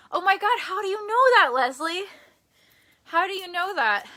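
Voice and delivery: high, loud disbelief; Oh my God, genuinely floored; shocked. Real, not acted. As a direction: gasp it out, stunned she knows. Shocked at psychic's reading.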